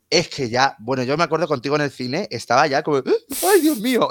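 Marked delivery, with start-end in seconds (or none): 3.0-4.1 high-pitched mock wail; retold overwhelmed cry. Fan recalls nearly breaking down in the cinema, overwhelmed. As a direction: act out being overcome, voice way up.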